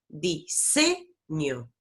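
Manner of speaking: diseño said slowly, middle syllable SE drawn out; stress on the middle syllable. Shown as a demonstration. Teacher showing the class which syllable is strong, stressed middle syllable. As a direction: lean hard on "se" in diseño. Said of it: "otorgarle mayor fuerza a una sílaba".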